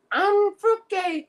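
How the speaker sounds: high, slow, hammed-up effeminate voice; mock effeminate voice. Mocking an inmate's pose in a photo, hammed-up high effeminate voice. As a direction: stretch it out high like a camp caricature. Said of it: "his whole demeanor scream".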